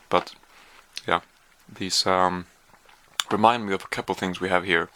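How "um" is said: ordinary talk, nothing marked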